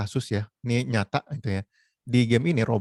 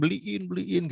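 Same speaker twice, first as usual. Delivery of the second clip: high mock-pleading repetition; begging child; 7 semitones higher, slower than usual. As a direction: nag mum in a child's voice. Child begging mother to buy.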